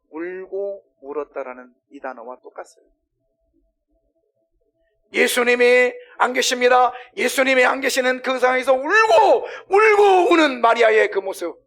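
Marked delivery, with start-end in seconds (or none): 5.1-11.6 high, loud, crying-out sermon voice; full-force pulpit outcry; impassioned preaching. Real, not acted. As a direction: preach at the top of your voice.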